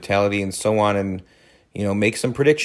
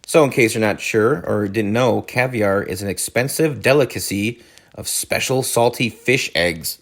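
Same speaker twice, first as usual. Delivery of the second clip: ordinary delivery, nothing marked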